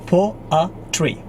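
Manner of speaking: "Po-a-tree" in three separate beats; syllable by syllable. Shown as a demonstration. Teacher teaching the word poetry, syllable by syllable. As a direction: break "Po-a-tree" apart slowly for learners. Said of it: "The correct pronunciation is".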